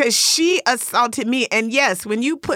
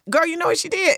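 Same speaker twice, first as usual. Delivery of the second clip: ordinary delivery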